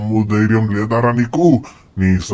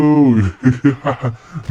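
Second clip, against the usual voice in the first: gloating cackle; triumphant villain laugh; 4 semitones higher than usual. Acted. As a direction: laugh over your fallen rival. Villain laughing after attack.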